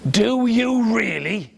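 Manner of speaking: dragon asking in disbelief; a big beast not believing; mock dragon disbelief; doubting dragon.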